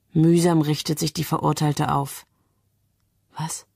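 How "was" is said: very soft, voiceless question; toneless. A narrator's reading. Condemned woman hears she is saved, asks tonelessly. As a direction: barely let the word out. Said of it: "fragt sie tonlos".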